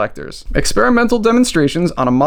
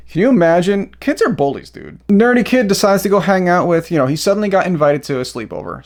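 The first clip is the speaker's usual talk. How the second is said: ordinary delivery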